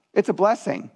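ordinary delivery

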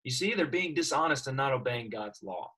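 usual speaking voice

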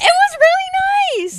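high, slow giggly voice; laughing. Real, not acted. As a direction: gush through a laugh. Dreamy memory, laughing, high and slow.

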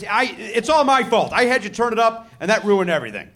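normal talking voice

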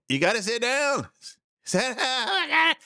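very high, squealing mock Italian gondolier; hammed-up Italian panic, voice shooting way up; comic Italian boatman at his wits' end; Italian accent. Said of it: "in his best Italian voice".

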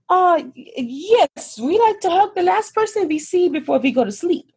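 high-pitched tipsy Swedish lady; Swedish accent. Retold or imitated. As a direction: gush in a hammed-up Swedish accent. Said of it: "try to do a Swedish accent".

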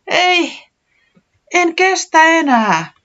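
high, loud cry of despair; exclaiming. A narrator's reading. Tiputi crying out at the chaos outside, high and loud. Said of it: "huudahti Tiputi".

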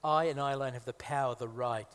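ordinary delivery

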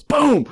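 high, loud mimicked bang; imitated impact boom. Storyteller imitating a pigeon hitting his bumper, explosive boom. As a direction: burst out the sound of the hit. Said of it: "and it goes".

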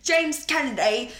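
a light mock British accent on the name; lightly imitating a trashy British accent; hint of James Kennedy British accent. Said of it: "a James Kennedy British accent".

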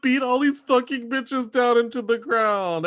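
high-pitched hammed-up tirade; mock furious rant. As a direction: act out a raging reality-show contestant. Impersonates furious phone-booth rant.

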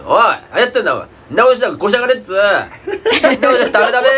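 high, loud mock scolding in Tohoku dialect; scolding a junior. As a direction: chew out a junior apprentice, hammed-up. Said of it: "弟弟子とかにね". (弟弟子を東北弁で叱る芝居)

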